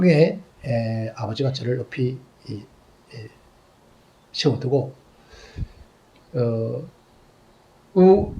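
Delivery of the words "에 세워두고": usual speaking voice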